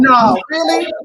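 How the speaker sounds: high, loud sarcastic disbelief; mock surprise. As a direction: pretend to be shocked, playing along with the joke. Host teasing guest's joke, mock disbelief.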